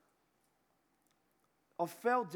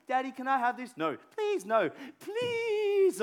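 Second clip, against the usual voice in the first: put-on high, louder pleading kid; child begging; 7 semitones higher, 6 dB louder than usual. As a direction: whine like a child wanting a treat. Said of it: "I'm a sucker for my kids".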